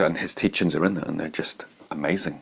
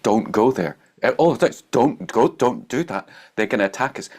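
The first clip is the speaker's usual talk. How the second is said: higher, louder pleading, mock panic as a scared follower; panicked disciples; 6 semitones higher, 4 dB louder than usual. Disciples begging Jesus to stop, high mock panic. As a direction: beg him not to go, half-mocking alarm.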